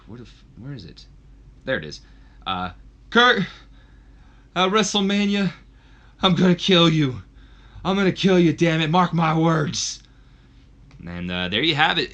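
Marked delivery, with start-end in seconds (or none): 3.1-10.0 vengeful threat. Acted. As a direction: swear revenge into the mic. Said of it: "he says into the mic".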